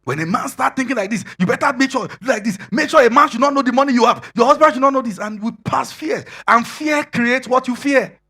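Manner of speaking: loud, high, driving sermon delivery; full-force preaching. Acted. Preacher warning the congregation against fear, in a loud, high, full-force voice. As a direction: hammer the warning about passing on fear.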